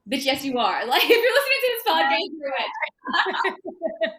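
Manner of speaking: loud laughing exclamation. Real, not acted. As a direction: burst out laughing as you insist.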